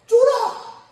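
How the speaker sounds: a sharp, punchy high yelp; sudden loud exclaim. Shown as a demonstration. Preacher punches the slang word at the congregation, high and loud.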